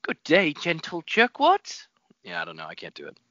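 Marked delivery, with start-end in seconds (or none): 0.0-1.9 put-on a somewhat higher, slow childish British voice; lightly voiced British child; Tinny Tim attempt. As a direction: try the earnest little boy. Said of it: "Tinny Tim attempt".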